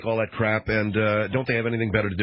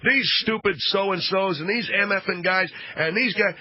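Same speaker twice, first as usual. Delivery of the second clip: higher, slow mock rant; retold top-of-voice rant, higher and slow; mock yelling rant; 7 semitones higher than usual. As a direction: play the ranting businessman, drawn out high. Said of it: "at the top of his voice".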